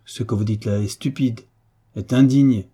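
ordinary delivery

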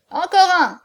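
high, hearty call for another round; loud challenge. A narrator's reading. Hunchback proposes another drink.

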